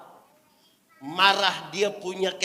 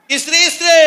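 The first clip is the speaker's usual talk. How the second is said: very loud, high call; 12 semitones higher, 11 dB louder than usual. Real, not acted. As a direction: holler for the crowd's response. Calling wives in the congregation. (seruan lantang bernada tinggi)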